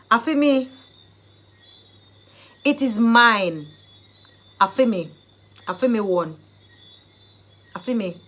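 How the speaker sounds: loud possessive Jamaican patois. Shown as a demonstration. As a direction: claim it loudly in Jamaican patois. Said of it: "the Jamaican dialect phrase".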